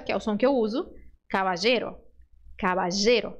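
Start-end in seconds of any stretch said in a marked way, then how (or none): 1.3-3.3 caballero said cabajero, LL as Portuguese J. Shown as a demonstration. Teacher showing the LL option she uses. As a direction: caballero with a buzzing J. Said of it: "som de J".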